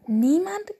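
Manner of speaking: high rising Niemand? in surprise; can't believe it; astonished. A narrator's reading. Father bear astonished at his son's tale. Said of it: "fragte Vater Eisbär erstaunt".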